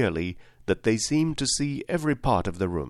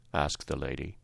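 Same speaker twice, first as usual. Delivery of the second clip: plain reading voice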